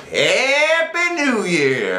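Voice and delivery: woozy party toast; drunken greeting. Retold or imitated. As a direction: slur it out like tipsy revelers. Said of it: "we said".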